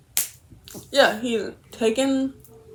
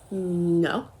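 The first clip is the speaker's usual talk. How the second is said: ordinary talk, nothing marked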